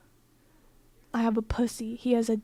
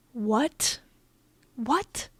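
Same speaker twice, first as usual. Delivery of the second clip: stunned "what?" with rising pitch; stunned disbelief. Retold or imitated. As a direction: replay the disbelief, each question rising. Retelling her stunned disbelief.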